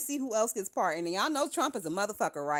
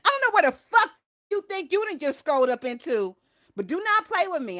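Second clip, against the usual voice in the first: pitched-up loud threat; high loud warning; 7 semitones higher, 5 dB louder than usual. Real, not acted. Streamer warns a chat troll, high and loud. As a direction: shriek the warning at them.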